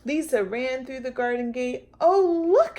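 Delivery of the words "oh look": high, loud delighted call; girl crying out at the vine; excited cry. Acted. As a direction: call out as if spotting a treasure. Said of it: "she cried".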